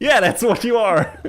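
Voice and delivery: high laughing put-down; mock-cheerful laughing jab; laughing tease. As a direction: tease him through laughter. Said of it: "It's like".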